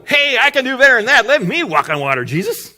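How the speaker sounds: loud, high, cocky volunteer; eager boasting. Retold or imitated. Another disciple begging Jesus for a turn, loud and eager. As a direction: call out to be picked. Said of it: "why didn't one of the other eleven go".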